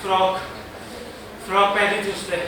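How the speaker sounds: soft, low, pained answer; subdued grief. Acted. Brother telling inspector what sister wore, subdued grief. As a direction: answer quietly, in pain.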